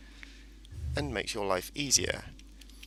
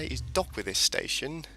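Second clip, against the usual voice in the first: normal talking voice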